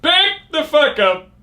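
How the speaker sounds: high, slow booming announcer; fighting game announcer. Shown as a demonstration. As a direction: announce it to the arena. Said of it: "my announcer voice".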